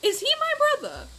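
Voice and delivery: high, startled ask; sudden alarmed question; shocked question. Real, not acted. Reading game choice aloud, shocked.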